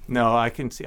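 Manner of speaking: ordinary delivery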